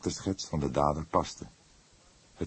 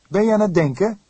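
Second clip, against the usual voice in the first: young detective's much higher, louder voice, lightly mocking; touch of sarcasm; 10 dB louder, faster than usual. A narrator's reading. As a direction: tease the old man a little. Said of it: "met een zweem van sarcasme". (met een zweem van sarcasme, hoog en luid)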